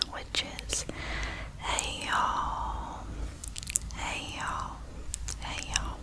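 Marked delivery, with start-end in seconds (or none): none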